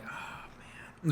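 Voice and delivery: breathy, soft "oh, man"; exasperated sigh. Retold or imitated. Driver reacting to the man who hit the car, exasperated sigh. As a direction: sigh it out, deflated.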